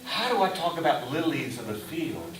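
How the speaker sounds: ordinary talk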